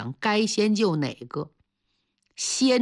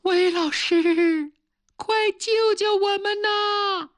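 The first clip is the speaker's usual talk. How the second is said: faint plea for help; 11 semitones higher, slower than usual. A narrator's reading. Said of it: "半天听到微弱的声音".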